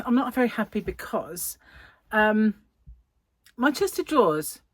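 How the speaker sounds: ordinary delivery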